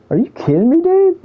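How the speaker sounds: high-pitched, incredulous retort; mock-outraged squeal rising on the last word; retold indignation. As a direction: act out an outraged comeback, voice shooting up. Retells angry reply to online insults.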